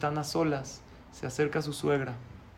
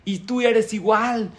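slow, loud, much higher cry; retold yell; 6 semitones higher, 10 dB louder, slower than usual. Mother-in-law yelling at the silent groom, loud slow shout. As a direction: hurl the blame at him, loud and slow. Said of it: "y a gritar".

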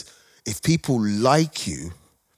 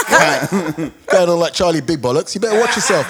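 mock warning through laughter; high, laughing put-down; British heckler; 6 semitones higher, 4 dB louder than usual. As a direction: take someone down a peg, laughing. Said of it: "British people are like".

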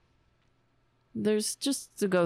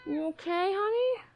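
high, cooing pet voice; much higher, doting coo; 13 semitones higher than usual. Real, not acted. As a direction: comfort an upset pet in a high sing-song. Soothing an upset pet.

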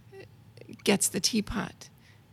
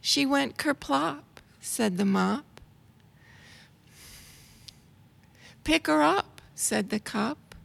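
put-on reading to children; 2 semitones higher than usual. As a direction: read it like a picture book to a child. Reads rhyming children's book aloud.

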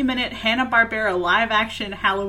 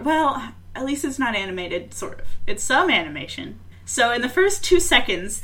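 ordinary delivery

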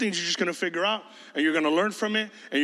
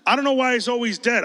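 higher mock whine; mock whiny teen complaint; 6 semitones higher, 4 dB louder than usual. Pastor mimicking teens' complaints, mock whine. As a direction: play the complaining teen, lightly mocking. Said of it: "mocking teen complaint".

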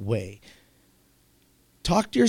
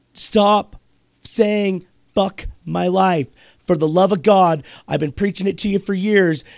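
raised, emphatic voice with pauses; exasperated plea; 2 semitones higher, 4 dB louder than usual. Real, not acted. Host pleading with Jessica to stop, exasperated and emphatic. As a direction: hammer it out word by word, then plead louder.

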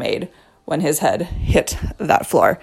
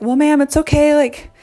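much higher, lightly voiced nurse; soothing nurse; 8 semitones higher, 3 dB louder than usual. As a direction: play the calm nurse calming a frantic mom.

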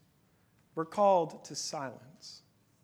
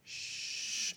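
whispered shush; gentle whispered shh; toddler's shush; 10 dB quieter than usual. Retold or imitated. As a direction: hush softly like a small child. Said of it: "she goes".

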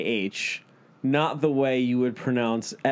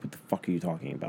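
baffled aside; 7 semitones lower, 8 dB quieter, much faster than usual. Retold or imitated. Retelling his baffled reaction.

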